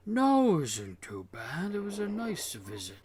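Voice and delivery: high-pitched, swooping reply; Dune's character voice. Acted. Dune reassures while helping with bags, high swooping voice. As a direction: open high and loud, then lilt through it.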